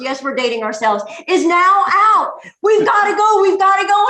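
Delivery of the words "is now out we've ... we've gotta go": excited urging. Retold or imitated. Husband urging to rush to movie.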